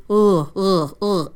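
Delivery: guttural zombie grunts; zombie groan. Shown as a demonstration. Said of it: "They sound like a zombie".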